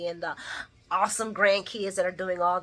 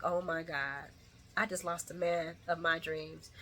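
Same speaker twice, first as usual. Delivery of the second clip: quiet lament; 2 semitones lower, 10 dB quieter than usual. Retold or imitated. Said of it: "Lucy's like".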